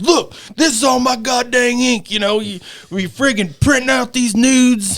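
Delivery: high, exaggerated scolding father; mock angry dad. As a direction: ham up an outraged dad catching his son. Dad finds the ink used.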